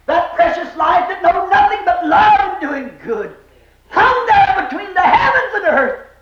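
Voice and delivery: high, fervent pulpit cry; impassioned preaching. Real, not acted. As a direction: preach it out with aching force. Preaches at full force.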